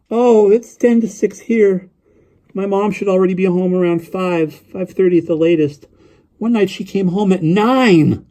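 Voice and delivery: put-on high boy's voice, running on eagerly; young boy rambling on the phone; chattering lonely boy. A narrator's reading.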